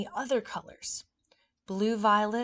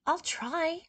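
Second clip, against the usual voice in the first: smiling; 8 semitones higher than usual. Acted. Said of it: "Tommy said with a big smile".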